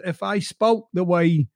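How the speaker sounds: usual speaking voice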